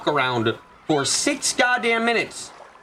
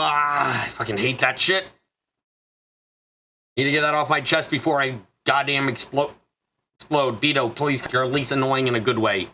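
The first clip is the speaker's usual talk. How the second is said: ordinary talk, nothing marked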